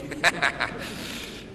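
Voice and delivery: a breathy little chuckle. Real, not acted. Laugh after family anecdote.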